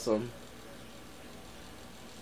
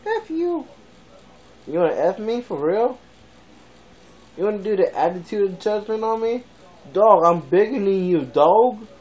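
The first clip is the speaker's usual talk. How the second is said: higher mock-tough pet voice; dog's voice; 6 semitones higher, 3 dB louder than usual. Vlogger voicing his dog picking a fight, higher. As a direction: play the dog trash-talking.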